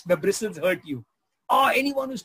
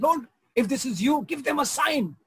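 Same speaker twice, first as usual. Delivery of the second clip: put-on old lady praying; 7 semitones higher than usual. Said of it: "she looked at them and said".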